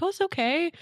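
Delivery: high consoling trainer voice; imitating the trainer. Trainer soothing the bored runner, high imitation.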